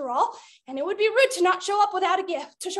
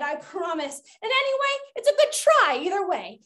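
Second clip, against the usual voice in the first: ordinary talk, nothing marked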